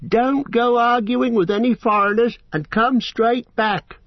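high-pitched wife calling out from the doorway; farmer's wife calling. A narrator's reading. Farm wife calling after her husband at the wagon, high and fond. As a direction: call after him, fussing like a farm wife. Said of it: "she called".

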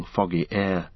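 usual speaking voice

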